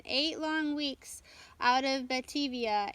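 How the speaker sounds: usual speaking voice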